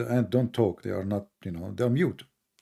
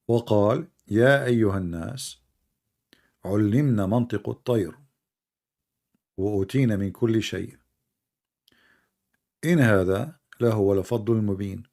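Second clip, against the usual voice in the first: fast Arabic verse recitation; Quran recitation; 3 semitones lower, much faster than usual. Acted.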